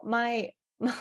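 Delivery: breaking into real laughter; giggling between the words; laughing. Real, not acted. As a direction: lose it to a laugh. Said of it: "laughing as I'm".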